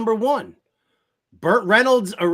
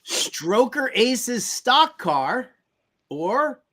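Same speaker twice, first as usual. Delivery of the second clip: normal talking voice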